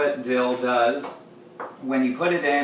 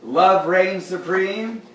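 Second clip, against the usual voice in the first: grand proclamation; 6 semitones higher, 6 dB louder, slower than usual. Real, not acted. Proclaiming to his cat.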